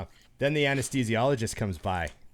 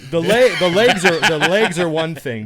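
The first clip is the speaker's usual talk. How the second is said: loud, higher, hammed-up protest; emphatic mock protest; 6 semitones higher, 11 dB louder than usual. Patient arguing with the nurse about numbness, loud mock protest. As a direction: plead your case loudly, half joking.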